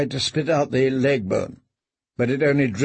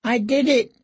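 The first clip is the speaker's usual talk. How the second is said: much higher, frightened admission; much higher pleading mumble; pitiful confession; 10 semitones higher than usual. Acted. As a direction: confess in terror. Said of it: "he mumbled".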